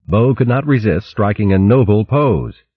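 ordinary delivery, nothing marked

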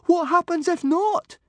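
very high, loud panicky squeak. A narrator's reading. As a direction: squeak the question in alarm. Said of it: "squeaked Fishlegs".

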